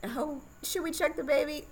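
quoting the midwife sweetly; high midwife voice. Retold or imitated. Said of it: "And she's like".

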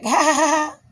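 acted-out laughing along as if it's a game; playful laugh. Woman thinking he's playing, retold playful laugh. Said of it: "I'm thinking he's playing".

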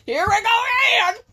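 loud, high fake groan of annoyance; mock exasperated shout. Imitating her friend's annoyed reaction, mock shout. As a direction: blurt it like a fed-up friend.

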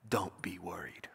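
low, soft near-whisper; hushed reassurance. Acted. Jesus answering someone's worries in prayer, hushed reassurance.

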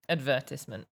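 Advertisement said as ad-VER-tiss-ment; low, soft Advertisement, stressing VER; British pronunciation. Shown as a demonstration. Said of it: "they're similar enough".

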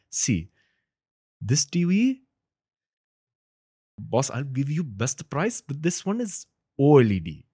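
high, lilting Indian-accented salesman. Shown as a demonstration. Guest plays TV salesman advising a buyer, Indian accent. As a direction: pitch the customer in a strong Indian accent, words stretched. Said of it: "with the Indian accent".

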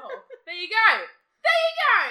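loud high excited yell; excited shout. Real, not acted. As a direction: shout it out gleefully. Excited echo.

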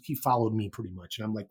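usual speaking voice

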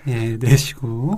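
slowed-down calm instruction; slow breathing cue. Acted. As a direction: draw it out to guide the exhale. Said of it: "doctor guides breathing". (천천히 숨 내쉬라는 말투)

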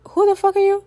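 high, shocked cry of who are you; stunned outburst. Retold or imitated. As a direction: blurt it out in high disbelief. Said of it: "In my mind, I'm just like".

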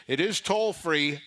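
ordinary talk, nothing marked

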